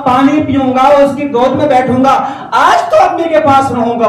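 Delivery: high, loud, slow and jubilant retelling; joyful preaching. Acted. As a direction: ring out the boy's delight at going home. Preacher voicing the joyful boy. (بلند آواز میں پرجوش خوشی)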